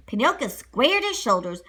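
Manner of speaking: usual speaking voice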